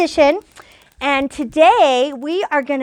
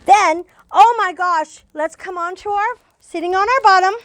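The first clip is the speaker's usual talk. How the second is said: ordinary talk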